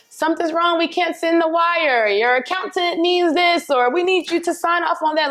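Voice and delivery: a hint of an urgent office caller; imitating the accountant. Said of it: "on the phone with my accountant".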